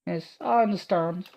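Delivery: put-on high, eager girl's voice; awed young heroine praising her protector; timid young girl. Awed Ada gushing at Martha, high and breathless.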